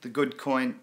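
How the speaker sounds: normal talking voice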